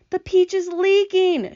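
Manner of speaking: high, slow, drawn-out cry of alarm; alarmed shout. A narrator's reading. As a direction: shout the bad news to everyone. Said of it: "shouted the old green grasshopper".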